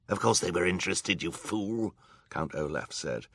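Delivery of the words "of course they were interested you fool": lightly sneering villain voice; a touch of quick contempt; mocking villain. A narrator's reading. Villain Olaf scoffing at the judge, mocking.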